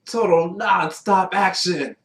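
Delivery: drawn-out hype announcer. Retold or imitated. Announcer style expansion.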